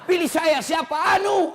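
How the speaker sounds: high, loud hammed-up candidate pitch; mock campaigning candidate. Candidate calling to voters, hammed-up and loud. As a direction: ham up a nameless candidate begging votes.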